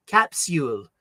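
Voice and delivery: normal talking voice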